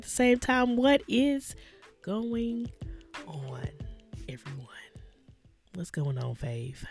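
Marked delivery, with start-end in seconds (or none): none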